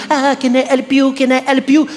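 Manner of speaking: high, fawning French-accented mimicry; mock over-eager. Frenchwoman mimicking fawning shop staff, over-eager. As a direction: pester like a desperate shop assistant.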